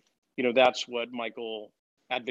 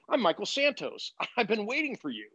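higher, beaming, with a laugh; lightly voiced cheerful mentor; Michael Santos; 9 semitones higher than usual. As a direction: greet him like an old friend you've waited for. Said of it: "He goes".

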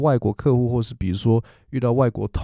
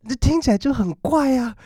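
high, odd-sounding aside; amused disbelief. Real, not acted. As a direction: squeak it out in disbelief. Remarking it sounds weird. (高声怪腔地说)

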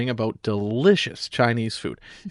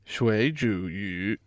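slow, softer echo of "水煮鱼"; slow repeat; 2 semitones lower, 5 dB quieter, slower than usual. Shown as a demonstration. Learner echoing the teacher, slow.

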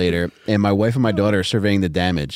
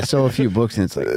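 normal talking voice

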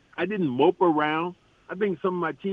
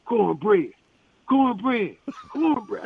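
calling out a name, building from normal to higher; a teammate hollering his nickname across the court, rising higher; yelling out; 5 semitones higher, slower than usual. Retold or imitated. Mock shout, growing higher each time. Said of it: "he starts yelling out".

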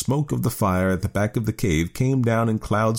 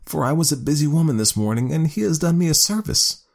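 woman voice; 10 semitones higher than usual. A narrator's reading.

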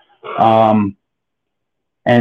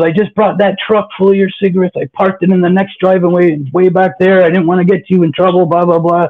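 imitated screaming; 5 semitones higher than usual. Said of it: "He's screaming in my face".

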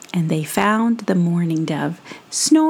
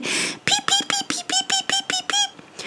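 tiny high peeping chicks; very high, soft chick peeps; baby bird peeps; 4 dB quieter than usual. Acted. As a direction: peep like hungry baby birds. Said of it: "all the little baby birds opened their mouth wide and said".

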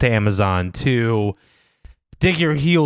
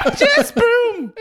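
high laughing witch voice. Pretend. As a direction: squeal it like a witch. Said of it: "sounds a lot like a witch".

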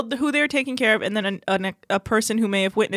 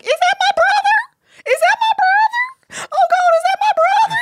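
very high, slow, louder wailing cry; mock hysterical Amy; 5 dB louder than usual. Host mocking Amy running to her brother, high crying wail. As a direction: wail it like a panicked sister. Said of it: "then why did you run over being like".

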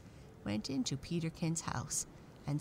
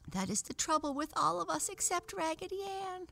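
very high, squeaky little doll. A narrator's reading. Little penny doll crying out to the other dolls, squeaky. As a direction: pipe it up high like a tiny doll. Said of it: "in a squeaky voice".

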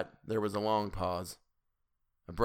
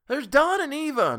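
high, loud desperate yell; 10 dB louder than usual. A narrator's reading. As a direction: yell it out in panic. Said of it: "Missus Hutchinson yelled".

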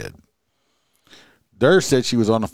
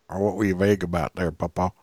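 quick, quiet, teasing jab; mocking challenge; 5 semitones lower, 6 dB quieter, faster than usual. Host teasing the grandfather, quick and quiet. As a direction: needle him to admit it.

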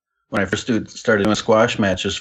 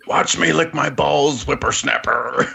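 put-on old prospector; 7 semitones higher, slower than usual. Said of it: "He says".

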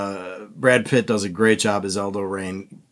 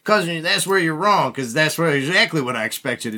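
lightly voiced movie tough guy, higher pitched; Brad Pitt; 5 semitones higher than usual. Retelling Brad Pitt's answer to his cousin, lightly imitated character voice. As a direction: lightly play the cocky film character correcting someone. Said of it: "Brad Pitt goes".

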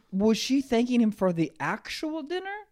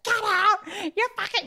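mock furious yell. Ex-girlfriend mid-breakup, high furious yell. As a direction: shriek it at him. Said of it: "but she's like".

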